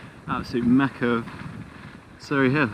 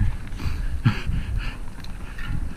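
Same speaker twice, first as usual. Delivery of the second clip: breathy laugh. Real, not acted. After dodging horse poo.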